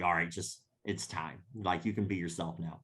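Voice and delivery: low hushed announcement. Retold or imitated. Quietly telling everybody it's time.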